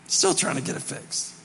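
unvoiced, muttered aside; quiet aside. Real, not acted. Pastor to congregation, a whispered aside. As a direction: drop it under your breath.

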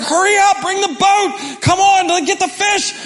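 high, loud, frantic orders; mock panicked shouting. Peter screaming at the crew to bring the boat, high frantic shout. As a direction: bark at the crew to hurry. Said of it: "And he's screaming".

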